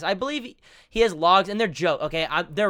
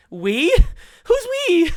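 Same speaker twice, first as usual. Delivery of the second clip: high, loud, exaggerated mock squeal; mocking impression; 12 semitones higher, 8 dB louder, slower than usual. Reactor mimics streamer dodging a question, high mocking voice. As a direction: shoot the pitch way up in mimicry. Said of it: "And Idaho's like".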